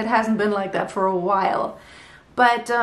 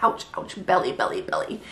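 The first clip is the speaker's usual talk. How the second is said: whispered ouches sinking lower and fading; pained ouches; 7 semitones lower than usual. Real, not acted. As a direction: wince through each word as the belly hurts. Pained while lying back down.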